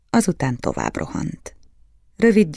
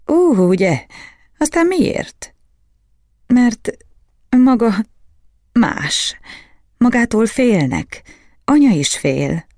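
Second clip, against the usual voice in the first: put-on child voice; 9 semitones higher, 5 dB louder, slower than usual. Boy and sorcerer dialogue voiced.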